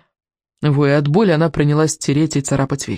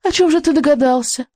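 high, needling witch; snide inquiry; 10 semitones higher than usual. A narrator's reading. Witch challenges the boy's guess, snide. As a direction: taunt with a much higher pitch. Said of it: "язвительно поинтересовалась ведьма".

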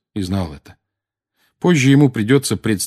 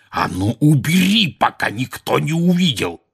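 angry roar; 6 semitones higher than usual. A narrator's reading. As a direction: still roaring, a little higher. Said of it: "проревел дядя Вернон".

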